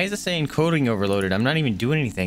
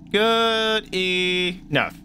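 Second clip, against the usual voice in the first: very slow, high drawn-out good enough; 8 semitones higher, 3 dB louder, much slower than usual. Pretend. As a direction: stretch it out in a silly voice. Drawn-out repeat of phrase.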